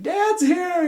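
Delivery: high, loud imitation of a thrilled son; excited child. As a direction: squeal it like a happy little boy. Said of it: "He's like".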